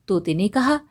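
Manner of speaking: usual speaking voice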